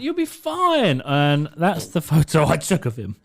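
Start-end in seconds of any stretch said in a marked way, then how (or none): 0.0-1.0 reassuring. Retold or imitated. Reassuring the boy.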